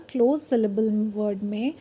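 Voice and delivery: ordinary talk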